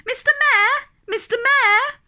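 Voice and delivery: high loud call across a distance. A narrator's reading. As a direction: call up to someone far away. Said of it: "Horton called".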